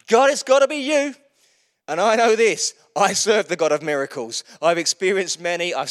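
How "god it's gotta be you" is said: desperate plea. Retold or imitated. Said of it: "where you're like".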